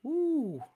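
high-pitched, drawn-out ooh. Shown as a demonstration. Reacting to their own accent, high long ooh. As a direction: let out a high mock-impressed ooh.

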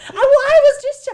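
high, loud laughing talk. Real, not acted. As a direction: laugh through the words. Laughing through the story.

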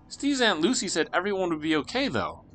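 a hint of a worried kid sister; anxious little sister. Sister presses her brother at breakfast, lightly voiced young girl.

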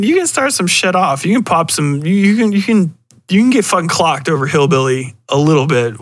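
ordinary talk, nothing marked

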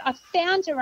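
mild mock yell of discovery; retold yell. Grandmother announcing the find, mild retold yell. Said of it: "I yelled out".

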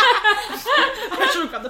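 loud, high, helpless laughter; squealing through real laughter; laughing hard. Real, not acted. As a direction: crack up while getting the words out. Helpless laughter over an imagined retort.

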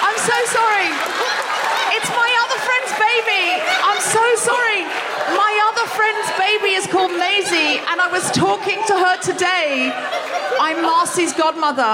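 apologise, laughing in horror; mortified laughing apology. Real, not acted. Mortified apology after wrong name.

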